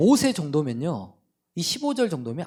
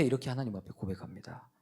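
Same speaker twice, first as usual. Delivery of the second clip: hushed whisper before the reading; preacher hushing before the verse; whispered lead-in; 6 semitones lower, 11 dB quieter, faster than usual. Real, not acted. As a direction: drop to a whisper to bring them in.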